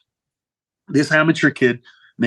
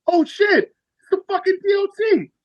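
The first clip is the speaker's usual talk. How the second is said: shocked recognition. Retold or imitated. Comedian spotting his idol in the crowd, high and thrilled. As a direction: leap way up high in surprise. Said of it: "I went".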